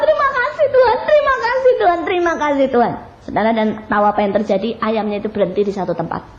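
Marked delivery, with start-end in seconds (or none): 0.0-2.9 much higher, tearful cries of thanks; crying thanks. Acted. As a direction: cry it out, fading at the end. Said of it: "nangis, dia bilang". (ucapan syukur sambil menangis, jauh lebih tinggi)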